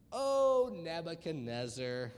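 slow, drawn-out mock-solemn address; lofty hail to a king; grand proclamation. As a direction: stretch the O out grandly. Mock-grand reply read from scripture.

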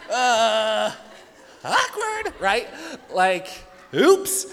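high laughing squeak of embarrassment; laughing awkward. Real, not acted. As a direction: cringe out loud. Wrong text reply embarrassment.

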